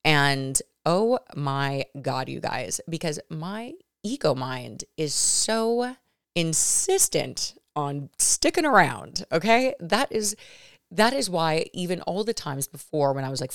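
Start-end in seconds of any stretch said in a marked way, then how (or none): none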